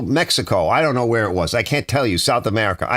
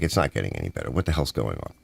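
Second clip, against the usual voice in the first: low exasperated mutter; 7 dB quieter, faster than usual. Retold or imitated. Retold exasperated thought, lower.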